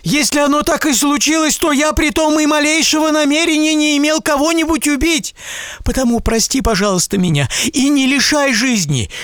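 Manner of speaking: high, urgent begging; pleading merchant. A narrator's reading. Merchant begging the giant not to kill him, high desperate pleading.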